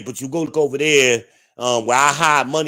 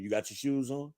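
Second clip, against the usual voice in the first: quiet friend imitation; 13 dB quieter than usual. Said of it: "That'd be all he gotta say to me".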